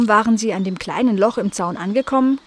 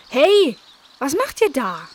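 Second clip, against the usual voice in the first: louder, higher startled hey; startled call; 6 semitones higher, 4 dB louder than usual. A narrator's reading. Nala catching the kittens digging in the bed, louder. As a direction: interrupt in alarm.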